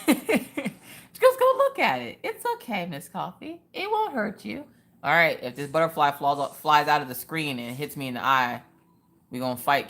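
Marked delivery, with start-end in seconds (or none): 0.1-4.6 put-on very high sing-song baby-talk reassurance; mock coaxing. Urging a scared viewer to look, high mock reassurance. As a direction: coax in a tiny, high voice.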